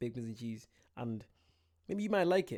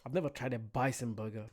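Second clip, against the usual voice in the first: normal talking voice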